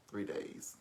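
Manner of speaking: hushed repeat of the count; whispered aside. Real, not acted. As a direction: whisper the repeat under your breath. Repeats the count under breath.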